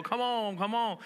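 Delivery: high urging call; rising encouragement from the pews; congregation urging. Retold or imitated. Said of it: "And they go".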